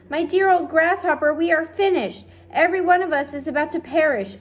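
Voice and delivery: high, loud cry of doom; despairing. A narrator's reading. Earthworm tells grasshopper they will perish, despairing cry. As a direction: wail that all is lost.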